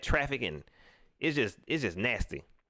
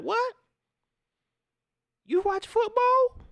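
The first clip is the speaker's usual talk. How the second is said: squeaky high, slow mock voice of a clueless critic; a high, drawn-out put-on voice of a baffled critic; mocking disbelief; 5 dB louder, slower than usual. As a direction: send it way up, sneering at the question. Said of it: "Be like".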